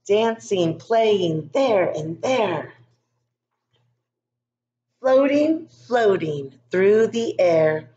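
sing-song, drawn-out storybook narration; lilting story reading. Acted. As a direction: let the words float like snow. Reads picture book to class.

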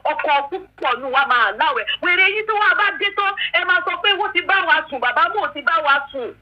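ordinary talk, nothing marked

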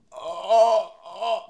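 high, drawn-out "oh" of pain; acted pained groan. Skater getting up after smashing his elbow, high pained groan. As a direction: moan it high, then fade.

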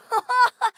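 loud, high laugh; sudden bright burst of laughter. Acted. Laugh after a weird moment.

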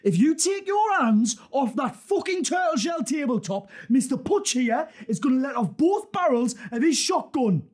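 high, forceful gunpoint order; shouted threat. Acted. Gunman ordering hands flat on the tabletop, shouted threat.

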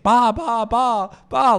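high, loud imitation of a wailing child; crying kid. Teller mimics a kid knocking hard, imitated loud crying call. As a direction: wail it like a small kid banging on a door. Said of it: "like, crying".

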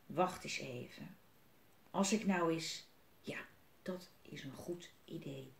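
soft, low thinking aloud; hushed musing. A narrator's reading. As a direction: trail down to a whisper as the idea forms. Willem thinks aloud of plan. (zacht peinzend, fluisterend)

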